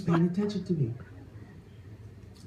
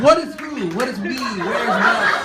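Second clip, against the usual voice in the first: high, louder confused questions; bewildered rant; 6 semitones higher, 5 dB louder than usual. Retold or imitated. Comic acting out his crisis of identity, high bewildered rant.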